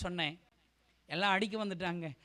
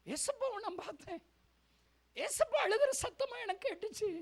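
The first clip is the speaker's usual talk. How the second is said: high, soft, tearful cry; tearful testimony; 11 semitones higher, 4 dB quieter than usual. Retold or imitated. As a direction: relive it in a weepy high voice. Relives hearing Jesus weep. (கண்ணீர் கலந்த மெல்லிய உயர்ந்த குரலில்)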